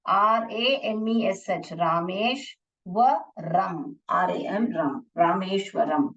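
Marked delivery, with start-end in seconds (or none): 1.7-3.9 रामेश व रम slowly in three separate chunks; teacher slowly sounding out रामेश - व - रम; split into syllables. Shown as a demonstration. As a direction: break it up as रामेश, व, रम. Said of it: "तो कैसे split करोगे आप?".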